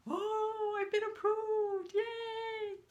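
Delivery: very high, sing-song squeal of joy; excited cheer. Acted. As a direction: cheer it high with long held vowels. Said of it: "I was actually excited".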